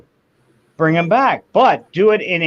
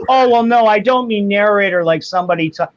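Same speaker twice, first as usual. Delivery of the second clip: mock whiny rebuttal; 6 semitones higher, 3 dB louder than usual. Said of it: "imitating the other man".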